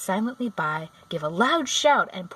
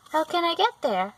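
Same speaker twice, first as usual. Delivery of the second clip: put-on higher curious girl; young girl voice; 9 semitones higher, 4 dB louder than usual. A narrator's reading. Dorothy asks the witch where the city is, high and eager. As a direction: ask it eagerly like a child.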